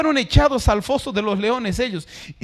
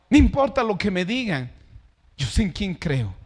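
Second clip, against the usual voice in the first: ordinary talk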